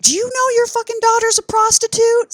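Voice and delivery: high-pitched retold rant; mock screaming. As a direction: imitate an outraged dad screaming, pitch way up. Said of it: "He's screaming at her".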